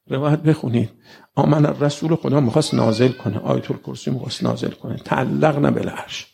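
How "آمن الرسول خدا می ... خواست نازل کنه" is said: normal talking voice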